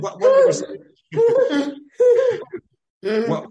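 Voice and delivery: words break into a high, loud laugh. Real, not acted. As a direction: lose it mid-question, laughing hard. Bursts out laughing mid-sentence.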